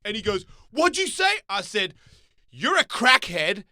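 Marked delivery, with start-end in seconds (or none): 0.7-1.4 very high, loud comeback; sharp retold confrontation; acted challenge. Stranger challenges him, retold. 2.5-3.6 loud, high, emphatic accusation; retold confrontation, pounding each word; angry retort. His retold furious retort.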